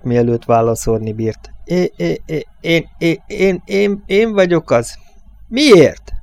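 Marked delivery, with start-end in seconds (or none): 1.6-5.0 high-pitched stuttering, word by word; halting, much higher nervous stutter; frightened stammering. A narrator's reading. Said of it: "Vagy fél percig makogott". 5.5-6.1 high, loud, startled cry; fearful, forceful shouted question; near shout. A narrator's reading. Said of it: "Ezt valósággal kiáltotta".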